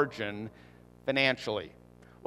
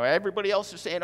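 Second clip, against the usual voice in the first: ordinary delivery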